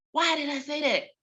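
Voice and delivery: plain reading voice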